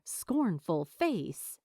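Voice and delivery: ordinary delivery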